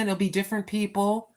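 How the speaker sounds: usual speaking voice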